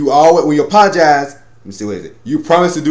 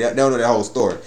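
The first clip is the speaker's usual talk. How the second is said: usual speaking voice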